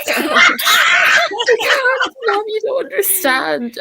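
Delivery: overwhelmed wail. Retold or imitated. Retold crying to mom.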